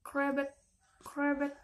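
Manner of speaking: lightly voiced frog croak, as a model; crisp "krebek, krebek" to be copied; mother frog's correct croak. Shown as a demonstration. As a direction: demonstrate "krebek" with a firm K at the start. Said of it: "begini suaranya".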